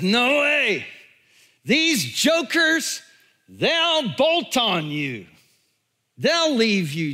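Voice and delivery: high, drawn-out boast of loyalty; cocky protest. Retold or imitated. Peter vows loyalty to Jesus, scornful of the others, cocky protest. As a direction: protest it high, stretching out the key words.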